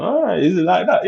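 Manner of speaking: high, incredulous retort; high-pitched mock challenge. Thinking back at a girl readying to fight, high challenge. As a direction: pitch it up like squaring up.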